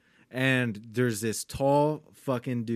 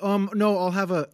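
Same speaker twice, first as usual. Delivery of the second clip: imitating a precise customer, pitched up; higher-pitched imitation of a proper order; proper enunciation; 7 semitones higher, 4 dB louder than usual. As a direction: pronounce each word carefully and politely. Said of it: "emphasize how much he pronounces every word".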